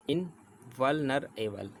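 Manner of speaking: इनवल्नरेबल slowly, part by part: in, val, nar, able; measured chunk-by-chunk इनवल्नरेबल for learners; divided into parts. Shown as a demonstration. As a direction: separate each piece of इनवल्नरेबल. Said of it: "चार part में divide कर लेते हैं".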